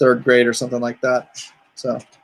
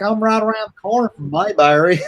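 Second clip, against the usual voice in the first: a light Mayberry Southern voice; Southern accent; 7 semitones higher than usual. Retold or imitated. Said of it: "they both talk like that".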